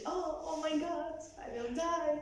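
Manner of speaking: mock-tragic, doing too much; exaggerated despair. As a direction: act out overdone operatic drama. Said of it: "It's exaggerated".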